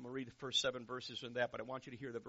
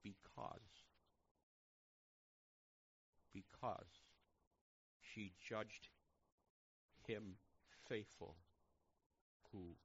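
hushed scripture reading; 3 semitones lower, slower than usual. Real, not acted. Reads scripture slowly and softly.

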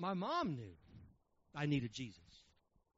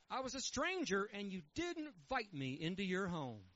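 measured, solemn reading of scripture; 2 semitones lower, 3 dB quieter than usual. Real, not acted. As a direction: read the verses with weight. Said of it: "let me read it to you".

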